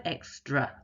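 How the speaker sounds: "extra" ending in a clear uh; careful EX-truh for learners; schwa demonstration. Shown as a demonstration. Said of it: "it's the A on the end of the word".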